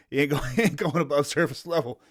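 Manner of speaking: real laughter breaking up the words; laughing while talking. Real, not acted. Joking about the little nephew, laughing while talking. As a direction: crack up midway through your own joke.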